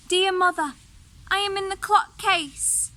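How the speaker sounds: put-on high little kid's voice calling out; youngest kid calling. Youngest kid calls to its mother from hiding, high and loud. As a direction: call up like a small hidden child. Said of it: "a soft voice cried".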